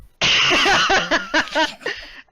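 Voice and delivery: high, loud burst of laughter; loud laughing fit. Real, not acted. Laughing at the cuddling line in the fanfic. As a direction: crack up loudly.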